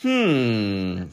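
long, falling hum; drawn-out thinking noise; pondering hmm. A narrator's reading. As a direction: hum slowly in puzzlement. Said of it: "scratching his head".

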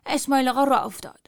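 usual speaking voice

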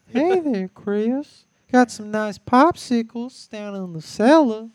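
loud, high old-creep impression with whistled S's; Herbert. Showing friends the whistling S's, loud high creepy voice. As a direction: project a high cartoon pervert voice, whistle every S. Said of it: "he'd do the Herbert voice".

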